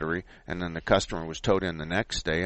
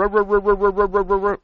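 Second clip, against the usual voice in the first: fast, high ruh-ruh starter noise; imitated engine cranking; 11 semitones higher, 5 dB louder, faster than usual. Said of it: "If it cranks over normally".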